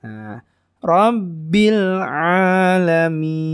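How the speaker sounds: slow chant, Alamin stretched long; Quran recitation. Shown as a demonstration. Teacher models verse ending in slow recitation. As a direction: recite Rabbil alamin with sustained final syllable.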